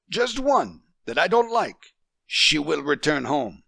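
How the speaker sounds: a father laying down the law to his wife; threatening. A narrator's reading. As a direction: raise the voice in a threat. Husband threatens, glare hardening.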